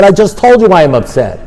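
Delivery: retold indignant comeback; exasperated retort. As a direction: protest as if nobody listens. Acts exasperated reply to sponsor.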